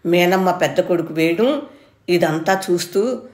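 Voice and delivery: ordinary delivery, nothing marked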